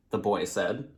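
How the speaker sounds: ordinary delivery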